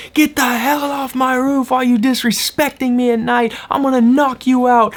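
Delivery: high, furious mock yelling; crazed man threatening, voice pushed way up; imitated screaming. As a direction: rant at a pitch far above your own. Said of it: "screaming at the top of his lungs".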